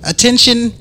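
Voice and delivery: teacher calling the room to order in a high voice; Becky voice. Retold or imitated. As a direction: summon the class like a chirpy teacher. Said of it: "her Becky voice".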